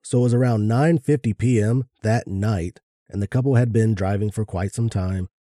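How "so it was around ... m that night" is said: normal talking voice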